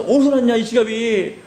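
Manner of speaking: father's voice; father asking. Retold or imitated. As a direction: ask where it came from. Father asks about wallet.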